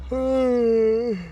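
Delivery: word stretched through a yawn. Real, not acted. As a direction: say it mid-yawn, sleepy. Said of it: "yawning 'cause I'm sleepy".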